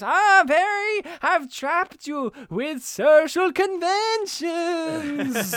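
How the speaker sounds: high, loud, drawn-out villain gloating; Doctor Doofenshmirtz. Retold or imitated. Villain springing his trap on Perry, high triumphant cartoon voice. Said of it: "and he goes".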